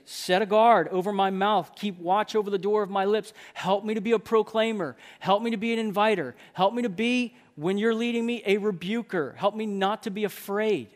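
fervent prayer. Acted. Man asking God to guard his mouth, fervent prayer. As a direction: plead to God with voice lifted. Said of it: "make that a matter of prayer".

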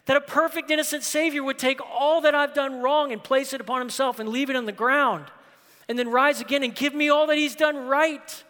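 loud, high, ringing sermon delivery; soaring pulpit proclamation; full-force preaching. Acted. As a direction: preach it out to the whole room. Preaching the great exchange.

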